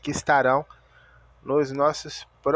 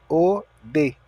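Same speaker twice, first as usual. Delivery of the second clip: ordinary talk, nothing marked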